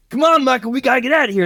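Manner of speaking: high, hurried-sounding cowboy call; urgent cowboy father. Acted. Cowboy father to Michael as eruption starts, high urgent call. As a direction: urge him out in a high voice.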